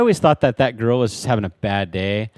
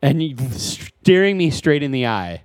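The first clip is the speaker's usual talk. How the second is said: ordinary talk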